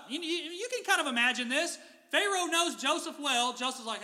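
ordinary talk

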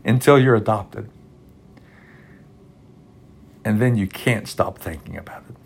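fighting back tears mid-quote; choked up. Real, not acted. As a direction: voice catching with real emotion, long pauses, trailing soft. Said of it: "I don't know why I'm emotional".

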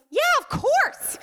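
put-on high, slow, easy child voice; sweet little boy. Son forgiving his mommy, high sweet child voice. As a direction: answer like a small child forgiving at once.

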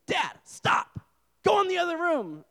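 dad imitating his small son, high-pitched; bossy toddler. Toddler son ordering dad out of the room, high and bossy. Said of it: "he does this thing now. He was like".

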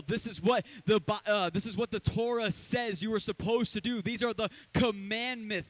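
loud, rising sermon build; fiery preacher voice, building; preaching. Acted. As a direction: drive it home to the congregation. Preaching at full force.

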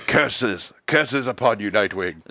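villain voice. Acted. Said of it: "Madman becomes a true villain".

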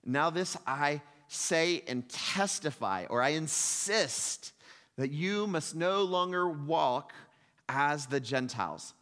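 slow, weighty solemn declaration; emphatic testimony. Retold or imitated. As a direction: pronounce each word like an oath. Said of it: "really emphatic language".

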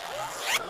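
sharp high startled gasp. Acted. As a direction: gasp like a frightened child. Mock fright at threat.

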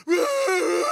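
loud high hammed-up bawl; mock child wail. The crying boy to the church lady, loud mock wail. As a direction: howl like a little kid in pain. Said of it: "I'm just like".

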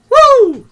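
loud, high celebratory "woo"; geeked whoop. Real, not acted. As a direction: let out a piercing fan's whoop. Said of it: "Man, I was geeked".

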